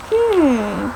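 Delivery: high "hmm" sliding down; loud, falling hum of reaction; reacting hum. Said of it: "she's like".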